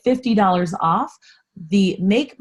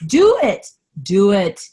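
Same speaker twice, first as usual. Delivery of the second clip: passionate yelling; 6 dB louder than usual. Real, not acted. Host urging listeners to start their project, passionate yelling. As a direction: yell it like a fired-up pep talk. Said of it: "I'm yelling at you. I got really passionate".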